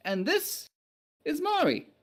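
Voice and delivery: slow, proud self-introduction; lightly voiced knight. Knight introduces himself formally.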